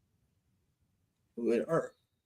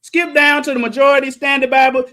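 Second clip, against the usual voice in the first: usual speaking voice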